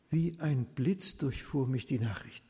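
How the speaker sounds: usual speaking voice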